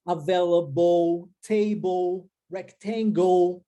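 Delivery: "Available, table, rectangle" each ending in "ou", slow; final -le as "ou". Shown as a demonstration. Teacher drilling final L E pronounced "ou". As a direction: stretch each word and end on "ou". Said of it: "L E no final, ou".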